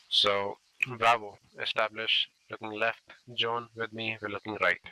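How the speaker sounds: loud commands called to the fire team; firm squad orders. Shown as a demonstration. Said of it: "I'll demonstrate how to do it".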